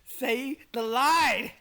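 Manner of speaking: slow, loud and drawn out; loud demand. Real, not acted. Friend yells at Lance to read his line. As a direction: drag each word out as a loud order.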